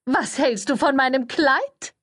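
sugary high-voiced schmoozer; gushing publicist. A narrator's reading. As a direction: coo it like an overeager press lady. Cordelia fishing for compliments.

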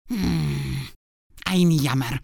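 a disappointed spider letting go; sighing spider. A narrator's reading. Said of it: "sagte die Spinne und seufzte".

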